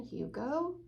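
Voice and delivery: ordinary delivery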